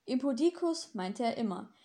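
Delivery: usual speaking voice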